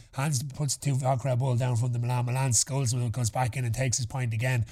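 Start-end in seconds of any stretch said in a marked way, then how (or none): none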